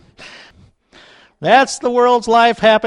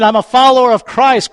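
ordinary talk, nothing marked

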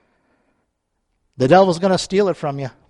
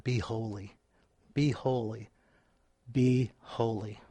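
soft scripture quote; 9 semitones lower, 17 dB quieter than usual. Acted. Reading scripture quote softly.

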